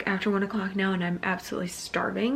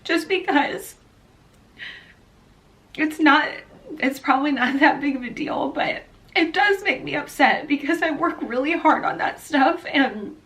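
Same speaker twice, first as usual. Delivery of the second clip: higher-pitched, real upset welling up; getting emotional; 6 semitones higher than usual. Real, not acted. Vlogger admitting it upsets her, emotional. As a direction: hold back real tears while explaining. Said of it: "I'm getting emotional".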